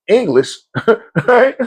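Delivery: usual speaking voice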